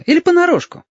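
put-on very high little boy's voice, eager question; excited child checking it is true; delighted child. A narrator's reading. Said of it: "обрадовался Гошка".

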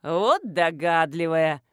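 slow, drawn-out derisive snort; snorting scorn. A narrator's reading. Village woman to a stranger at her fence, snorting scorn. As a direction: scoff at her, dragging the words. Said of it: "фыркнула баба".

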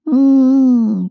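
high loud closed-mouth "mmm"; muffled moan. A narrator's reading. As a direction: moan through a hand over the mouth. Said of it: "промычала она в ладонь".

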